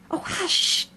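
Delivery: high, breathy hush; alarmed, whispered hushing; frightened whisper. A narrator's reading. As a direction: shush her in fright. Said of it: "whispered in a frightened tone".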